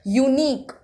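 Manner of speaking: "यूनिक" said alone, opening on a y sound; consonant-sound demo. Shown as a demonstration. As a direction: show the y glide starting "यूनिक". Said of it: "वाई consonant की sound आ रही है". ("यूनिक" में शुरुआती य की ध्वनि दिखाते हुए)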